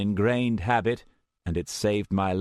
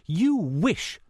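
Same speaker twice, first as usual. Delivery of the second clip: scornful; 12 semitones higher than usual. A narrator's reading. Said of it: "Meredith gave a shaky laugh".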